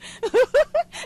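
very high, loud cackle; shrieking burst of laughter; hard laughter. Acted. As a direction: cackle loudly at the gossip. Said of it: "làm gì mà cười dữ vậy".

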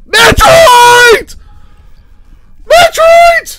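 screaming the name at the top of his lungs; real shock and joy, yelled high; ecstatic shout. Real, not acted. Viewer yelling at a reveal, ecstatic scream. Reacting live to Nintendo reveal.

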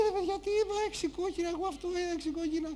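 high, soft protesting voice; bewildered George. Acted. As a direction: plead bewildered against the laughter. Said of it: "ο Γιώργος είχε μείνει".

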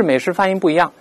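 ordinary delivery

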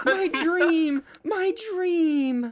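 high, loud hammed-up delight; mock ecstatic cry. Player gloating at officers, hammed-up squeal. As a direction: squeal it like a dream come true. Celebrating mock arrest loudly.

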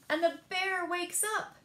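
ordinary delivery

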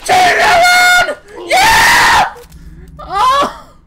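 high, loud drawn-out yell; excited shouting. Real, not acted. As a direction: scream it at the enemy. Yelling at the enemy.

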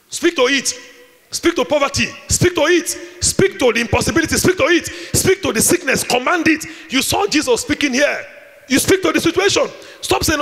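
loud, high, commanding preacher's call; rising fervent sermon exhortation; preaching at full force. Acted. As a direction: drive it home to the congregation.

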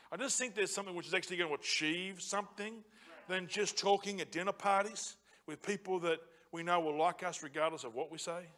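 usual speaking voice

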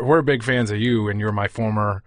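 ordinary talk

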